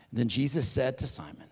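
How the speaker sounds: normal talking voice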